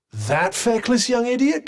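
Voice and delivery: high-pitched incredulous scorn; scornful disbelief. Acted. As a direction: question it in appalled disbelief. Disbelief at someone's choice.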